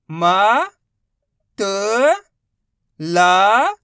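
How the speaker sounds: म, त, ला held long, each separate; drawn-out letter drill on म, त, ला; sounding out letters. Shown as a demonstration. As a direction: call out म, त and a loud ला with pauses. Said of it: "एक ही अक्षर का उच्चारण करके".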